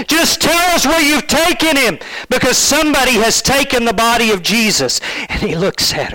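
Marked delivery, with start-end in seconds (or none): 0.1-5.0 high, pleading voice of a weeping woman; tearful plea. Acted. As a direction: beg through tears, pitched up high. Mary Magdalene tearfully pleading.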